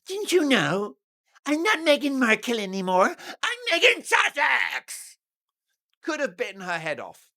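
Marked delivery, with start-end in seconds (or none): 0.1-5.2 high, loud, indignant snap rising to a shout; mock Meghan outburst. Commentator mocking Meghan's rage at the actress, high and shrill-loud. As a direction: play the offended duchess correcting her. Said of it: "Flash of rage in Meghan Markle's eyes".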